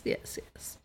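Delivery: whispered mock agreement; whispering. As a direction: breathe it out mockingly. Mocking whisper aside.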